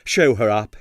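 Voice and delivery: ordinary delivery, nothing marked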